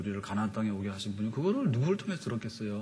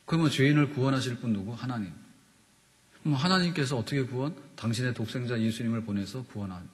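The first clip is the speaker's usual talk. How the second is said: ordinary talk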